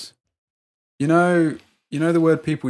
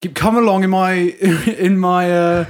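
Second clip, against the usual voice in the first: hammed-up grumbling old man, louder and higher; mock ranting old bloke; 7 semitones higher, 6 dB louder than usual. Retelling a customer's rant to a friend, mock indignant old bloke.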